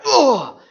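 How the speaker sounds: high, loud imitated "ugh" of impact; pained grunt. Telling the congregation about a thrown medicine ball, high mock grunt. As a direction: grunt as if hit by a heavy ball. Mimics catching a heavy ball.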